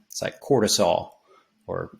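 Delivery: ordinary delivery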